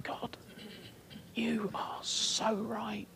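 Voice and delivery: breathless whisper of surrender; hushed prayer. Retold or imitated. Preacher retelling his prayer to God, whispered.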